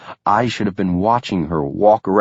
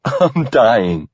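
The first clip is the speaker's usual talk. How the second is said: laughing; 4 semitones higher than usual. A narrator's reading. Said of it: "I laugh out".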